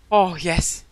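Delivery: loud, elated cries of relief; relieved outburst. Real, not acted. Player who has just made it through, relieved outburst. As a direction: cry out loudly, stretching the last exclamations.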